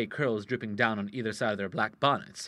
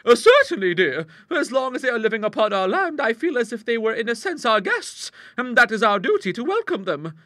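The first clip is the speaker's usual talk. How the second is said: high, emphatic ladylike character voice; prim maiden sisters; 12 semitones higher, 8 dB louder than usual. Acted. Maiden sisters agreeing on a duty call, high and emphatic. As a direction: play a dutiful lady in a raised voice. Elderly sisters talk in a story.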